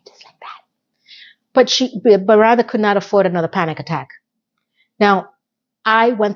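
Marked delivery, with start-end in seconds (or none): 0.1-0.6 breathy whispered aside. Real, not acted. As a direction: drop to a hushed whisper. Aside after quoting narration.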